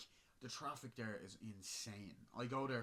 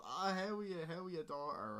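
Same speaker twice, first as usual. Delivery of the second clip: high put-on cheery stranger; mock chirpy greeting; 8 semitones higher, 5 dB louder than usual. Mocking the stranger greeting the daughter, high and chirpy. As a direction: ham up his fake friendliness.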